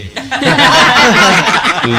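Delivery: high burst of laughter; falling peal of laughter. Real, not acted. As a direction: break into a real laugh. Laughing at own joke.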